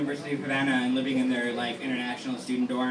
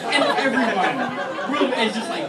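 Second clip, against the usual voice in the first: words broken up by laughter, pitch up and louder; retelling the group's horror while laughing; laughing through it; 11 semitones higher, 5 dB louder, slower than usual. Real, not acted. The teller laughing hard at the group's shock.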